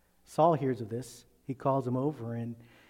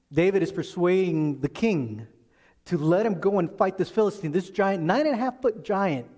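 normal talking voice

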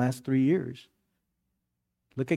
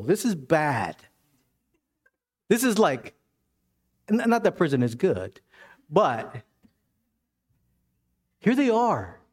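preaching at full force; 8 semitones higher than usual. Acted. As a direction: drive the story home to the congregation. Preaching at full force about Paul.

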